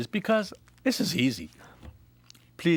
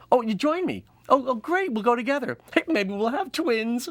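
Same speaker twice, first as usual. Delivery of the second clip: higher, cheery invitation; delighted lady voice; 8 semitones higher than usual. Acted. Vanessa inviting her friend along, higher cheery voice. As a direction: bubble over with excitement.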